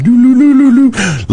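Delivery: loud high sing-song loo loo; mock TV noise. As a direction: drown him out like a blaring TV. Imitating TV volume going up.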